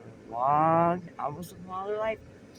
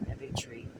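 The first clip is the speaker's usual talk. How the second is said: whispering; 5 semitones lower, 8 dB quieter than usual. Real, not acted. Whispered aside while filming.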